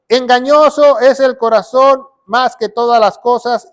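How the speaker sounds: loud, declamatory Bible reading; scripture proclamation. Acted. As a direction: proclaim the verse loudly to the congregation. Reads scripture out loudly.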